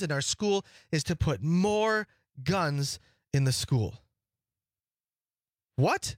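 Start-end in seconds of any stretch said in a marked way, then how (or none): 5.7-6.1 high, rising disbelief; incredulous. Real, not acted. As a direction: ask it as if you cannot believe it. Incredulous at officials' proposed solution.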